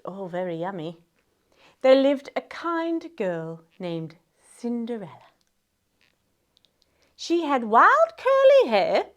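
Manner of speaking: plain reading voice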